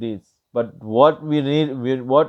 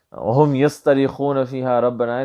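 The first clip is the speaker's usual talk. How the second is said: ordinary delivery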